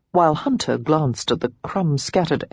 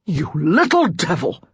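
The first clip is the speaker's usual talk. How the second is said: exploding outburst. A narrator's reading. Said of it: "Hunter exploded".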